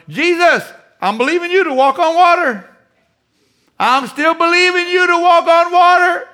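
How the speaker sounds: very high, loud, strained shout; hammed-up wail of straining faith; desperate mock cry. Mocks believer crying out to Jesus.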